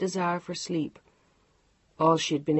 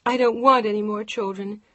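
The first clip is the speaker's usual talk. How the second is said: louder, raised protest; 4 semitones higher, 6 dB louder than usual. A narrator's reading. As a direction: push back hard. Wife shouting in quarrel.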